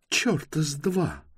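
high, slow exclamation of dissent; mock cry. A narrator's reading. Bayta contradicting Toran, indignant cry. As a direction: cry out high without real volume. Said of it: "крикнула Байта".

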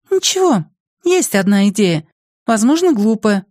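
put-on high-pitched girl, unsure of her idea; high, tentative girlish voice; hesitant girl. A narrator's reading.

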